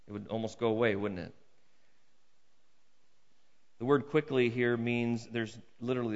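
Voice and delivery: ordinary talk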